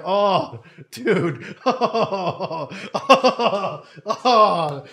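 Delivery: overwhelmed laughing oh. Real, not acted. Overwhelmed by the widower's letter.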